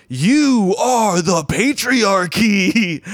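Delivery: drawn-out, high, loud heckler cry; imitating a heckler's long accusing holler; mock yell. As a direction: yell it slowly, stretching out every word. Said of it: "she just yells".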